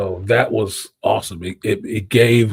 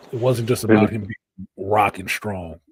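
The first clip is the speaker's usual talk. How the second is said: normal talking voice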